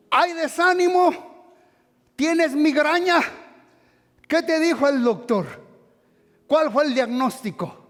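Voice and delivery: loud, high-pitched sermon questions; fiery preaching. Acted. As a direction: challenge the crowd at full force. Preaching at full force.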